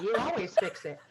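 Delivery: put-on retelling the kids' line with a laugh; laughing quote. Quoting her kids' complaint.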